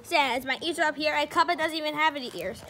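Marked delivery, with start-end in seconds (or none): none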